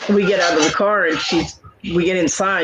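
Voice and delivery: ordinary delivery, nothing marked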